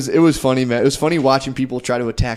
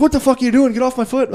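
imitating the Irish lady; 12 semitones higher than usual. Irish lady yelling at him to get off her foot, high mock outrage. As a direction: squeal it like the offended Irish lady.

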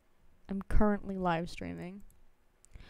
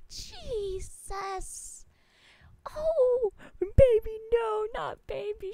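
mock anguish; 12 semitones higher, 3 dB louder, slower than usual. High anguished wail over baby.

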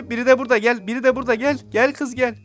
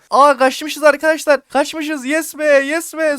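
relieved cheer; 5 semitones higher, 5 dB louder, slower than usual. Real, not acted. Relief after escaping the chaser.

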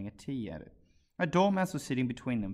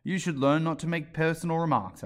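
severe; 5 semitones higher, 4 dB louder than usual. A narrator's reading. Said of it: "with some severity".

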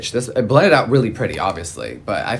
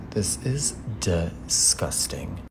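slow hushed disgust; whisper; 5 semitones lower, 10 dB quieter, much slower than usual. Real, not acted. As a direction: breathe out the verdict. Whispered verdict.

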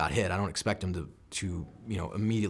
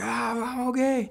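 much higher, strained voice; strained reply; 3 dB louder, slower than usual. Retold or imitated. Wounded marine answering his sergeant, high strained voice. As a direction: squeeze it out high like a hurt man. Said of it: "And he's like".

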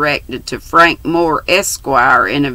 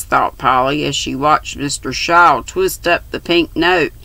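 ordinary delivery, nothing marked

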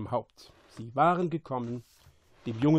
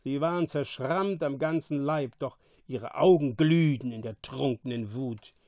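plain reading voice

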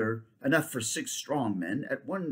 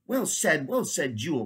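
high, louder glad cry; cried out; 4 semitones higher, 4 dB louder than usual. Acted. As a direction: cry out in delight. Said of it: "cried the king".

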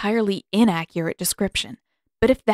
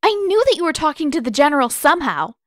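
much higher, louder accusation; accusing; 10 semitones higher, 4 dB louder than usual. A narrator's reading. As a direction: hurl the accusation, rushing at the end. Katara accusing Pakku.

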